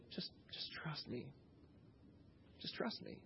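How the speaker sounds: very soft, near-whispered plea for trust; hushed reassurance. Acted. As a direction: reassure them almost under your breath. Acted Jesus quietly reassuring the servants.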